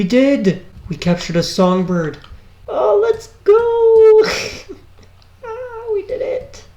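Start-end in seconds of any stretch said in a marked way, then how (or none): 2.7-6.6 high, drawn-out cheer; excited squeal. Real, not acted. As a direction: squeal with delight. Celebrates catching the bird.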